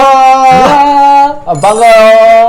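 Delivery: drawn-out shouted greeting; loud greeting. Real, not acted. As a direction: call out the hello to viewers. Greeting the stream loudly. (길게 외치는 인사)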